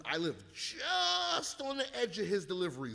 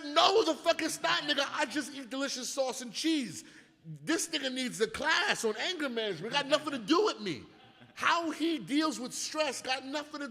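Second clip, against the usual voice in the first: higher and louder, laughing indignation; indignant mock yell; 9 semitones higher, 5 dB louder than usual. Answering accusers with loud mock indignation. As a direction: blast back in outrage. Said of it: "I said".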